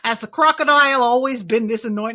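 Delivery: Brooklyn attempt veering into Australian; Australian accent. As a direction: let a bad accent slide into Australian. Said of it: "Now I'm getting Australian".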